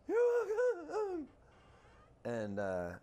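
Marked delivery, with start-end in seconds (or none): 0.1-1.3 much higher, frightened mock voice of the kid; scared new hire. Mocking a scared new hire to his coworker, frightened high voice. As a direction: squeak it out like a terrified kid. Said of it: "the tone and the intonation".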